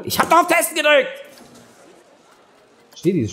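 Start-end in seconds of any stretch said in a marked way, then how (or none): 0.0-1.1 frustrated outburst. Real, not acted. Game button not working, frustrated.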